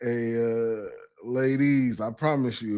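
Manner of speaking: ordinary delivery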